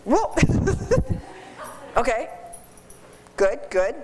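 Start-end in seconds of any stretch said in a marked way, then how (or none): 0.4-1.3 startled laugh. Real, not acted. Surprise in the demo.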